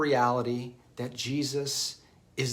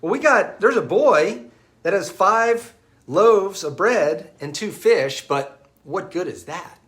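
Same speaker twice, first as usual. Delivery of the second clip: higher and louder, slow at first, trailing off doubtful; doubtful Andrew; 4 semitones higher, 6 dB louder than usual. Retold or imitated. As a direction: offer something you know is too little. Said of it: "And then Andrew says".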